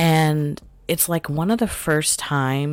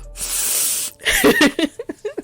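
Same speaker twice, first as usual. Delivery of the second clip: gasping laugh. Retold or imitated. Woken by smelling salts.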